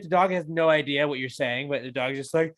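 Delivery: normal talking voice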